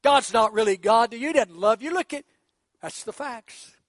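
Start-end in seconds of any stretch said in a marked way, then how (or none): 0.0-2.2 very high, loud mocking "See?"; devil taunting. The devil mocking a sick believer, high and loud. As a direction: jeer at the listener like the devil. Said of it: "and the devil's saying".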